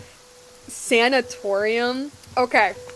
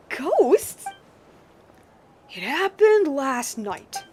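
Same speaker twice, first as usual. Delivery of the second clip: put-on very old man; 3 semitones higher, much slower than usual. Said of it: "my old man voice".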